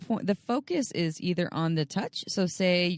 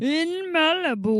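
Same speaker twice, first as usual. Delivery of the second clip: higher, slow attempt at an Austrian accent; failed Schwarzenegger impression; 10 semitones higher, 3 dB louder, slower than usual. Copying the Malibu line, in a weak Austrian accent. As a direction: try and miss the Austrian accent. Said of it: "I can't do it".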